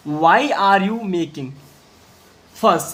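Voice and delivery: slow, word by word reading; slow read-aloud. Shown as a demonstration. Teacher reading the sentence slowly to students. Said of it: "ध्यान से देखिएगा".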